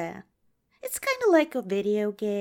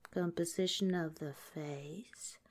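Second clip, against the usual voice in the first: whispering; 8 dB quieter than usual. Acted. Murmured while studying the face.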